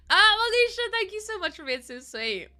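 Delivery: loud high exclamation; bright burst of recognition; excited greeting. Real, not acted. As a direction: call the name out with delight. Greets a gifting viewer.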